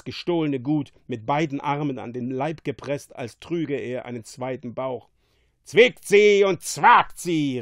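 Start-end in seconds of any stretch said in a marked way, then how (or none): 5.6-7.6 loud, high, slow command; punchy, drawn-out battle cry; calling out. A narrator's reading. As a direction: urge the hounds on with a shout. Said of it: "rief Prospero".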